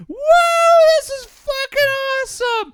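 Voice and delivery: very high, loud thrilled whoops; joyful yelling. Retold or imitated. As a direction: scream with delight while being towed. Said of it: "one of us yelling".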